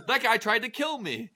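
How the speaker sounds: high-pitched, hammed-up alarm; mock alarmed accusation. Napoleon accusing a man before his soldiers, mock high-pitched alarm. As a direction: cry out a fake accusation.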